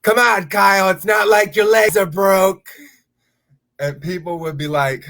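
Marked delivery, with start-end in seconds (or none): 0.0-2.7 higher, louder teasing call; mock yell. Brother teasing Kyle loudly in a public place, mock yell. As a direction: yell it back across the crowd. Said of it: "and he'd yell back".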